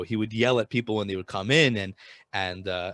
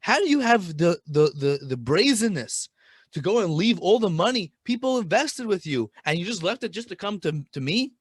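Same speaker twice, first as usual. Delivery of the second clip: retold yelling; 7 semitones higher than usual. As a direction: berate him like an outraged rebbe. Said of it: "Reb Baruch yelled at him".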